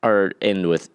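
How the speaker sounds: ordinary talk, nothing marked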